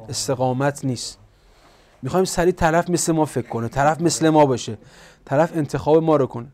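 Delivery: usual speaking voice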